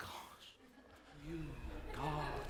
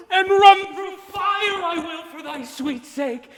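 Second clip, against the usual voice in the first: passionate outburst; 6 semitones higher, 4 dB louder than usual. Acted. Waking lover's sudden passion.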